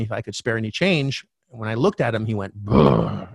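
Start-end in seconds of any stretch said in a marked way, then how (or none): 2.5-3.3 low, forceful "brr"; startling low brr, mimicking a stranger; imitated homeless man's noise. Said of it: "I remember that sound".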